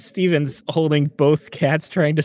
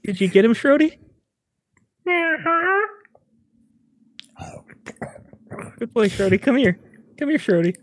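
higher, coaxing pet talk; pet-owner coo; 9 semitones higher than usual. Acted. Owner calling the pet back from the fight, high coaxing. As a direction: coax your pet over, pitch lifted.

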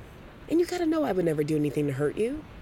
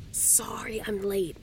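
whispered; 6 dB quieter, slower than usual. Acted. Arriving late, whispered apology.

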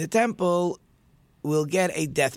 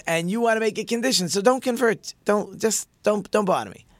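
higher, louder brush-off that slows at the end; annoyed dismissal; 7 semitones higher, 4 dB louder than usual. Acted. Shammai turning the demanding convert away, irritated and loud. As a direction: shoo him away, fed up.

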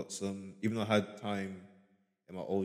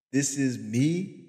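higher, louder, slow wondering rise on me; louder stunned disbelief; 7 semitones higher, 11 dB louder, much slower than usual. Retold or imitated. To his listeners, stunned at finding himself, louder disbelief. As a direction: hit the second ask bigger, like it just landed. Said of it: "and you're like".

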